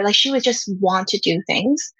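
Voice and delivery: normal talking voice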